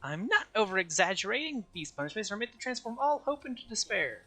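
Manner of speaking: high, gleeful cartoon bear voice; gloating squeaky mascot with a laugh; Monokuma voice. Plays Monokuma.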